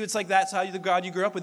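ordinary delivery

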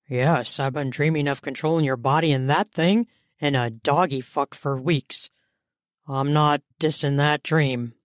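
low-pitched man's voice; male lover. Acted. Man reassuring his impatient lover, low male voice. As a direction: play the man, pitched well down.

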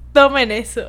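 laughing loud shout; triumphant. Real, not acted. Tells viewers to take the advice, laughing loudly. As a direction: fling it at them with glee.

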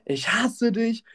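high, slow mock scream; imitated screaming. Woman attacking her partner, mock screaming. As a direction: draw out the threat like someone hysterical. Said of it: "Und hat sie geschrien".

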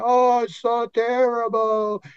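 high, slow, self-pitying mock whine; mock victim whine. Imitating someone playing the victim, high and slow. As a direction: play up the poor-me victim, drawn out. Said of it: "We wanna do the victim role".

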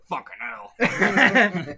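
slow, lightly gruff smoker voice; a hint of an old smoker, drawn out. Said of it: "a really gruff smoker voice".

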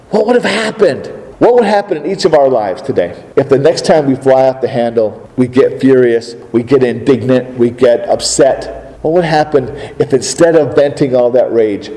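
usual speaking voice

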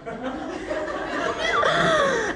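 long, high put-on laugh; showy fake laugh. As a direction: laugh big and high so he sees it from afar. Acting out flirty laugh for onlookers.

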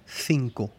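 "cinco" with the c as English th; Castilian TH. Shown as a demonstration. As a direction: say "cinco" like "THEEN-ko", as in Spain. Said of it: "en español de España". («cinco» con la zeta de España)

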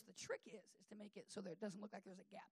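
low-volume acted conspirator; retold confiding. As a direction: share a sneaky secret under your breath. Uncle confides his cover-up trick.

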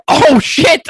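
the shock of seeing the fire; startled curse. Acted. Uncle realizes the shed burns.

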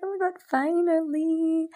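high, giddy relief; thrilled. Retold or imitated. Host reliving her new mic arriving, giddy and high. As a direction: squeal it like the wait is over. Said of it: "I was so fucking excited".

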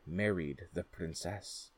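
plain reading voice

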